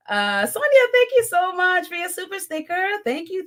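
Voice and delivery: ordinary delivery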